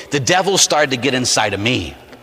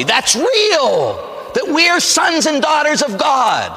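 high, slow preacher's shout; emphatic proclamation; 11 semitones higher, much slower than usual. Real, not acted. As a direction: proclaim it drawn out at high pitch. Preacher's climax on the main point.

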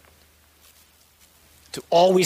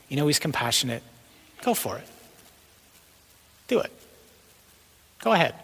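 gentle enticing devil. Acted. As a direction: tempt gently, with long pauses, ever quieter. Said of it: "speaking in this very soft voice, very subtly, very quietly".

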